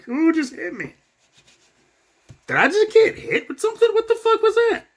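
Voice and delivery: high, bewildered cries of surprise; hammed-up high-pitched confusion; confused victim. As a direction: sound stunned, not knowing what hit you. Victim voiced, confused by hit.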